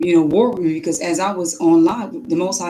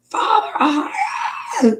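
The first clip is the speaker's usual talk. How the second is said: very high, crying shout; retold sobbing prayer, way up high; crying out to God. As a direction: wail to Father in despair. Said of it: "I said".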